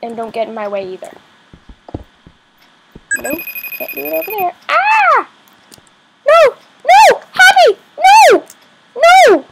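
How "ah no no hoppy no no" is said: very high, loud screaming no; shrieking, much higher and louder cries; panicked screams. Real, not acted. Bunny falls again.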